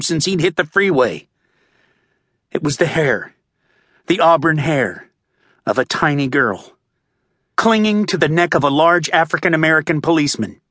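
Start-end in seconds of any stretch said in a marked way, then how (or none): none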